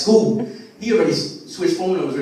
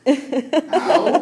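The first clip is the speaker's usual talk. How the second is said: high, hammed-up little kid yelp; fake ow; 10 semitones higher, 3 dB louder than usual. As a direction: squeak out a fake hurt. Son fakes pain.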